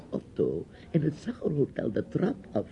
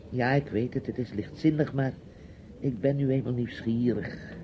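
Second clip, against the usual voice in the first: ordinary delivery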